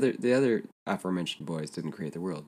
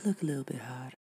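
put-on creepy childlike coaxing voice; Little Gideon voice; 7 semitones higher, 3 dB quieter, faster than usual. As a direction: coax like a creepy child preacher. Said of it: "You're doing the Little Gideon voice".

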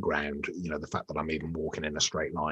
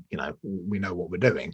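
usual speaking voice

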